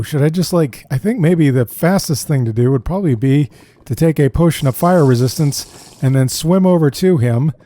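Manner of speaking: ordinary talk, nothing marked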